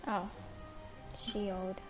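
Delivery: ordinary talk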